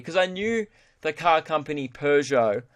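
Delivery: ordinary talk, nothing marked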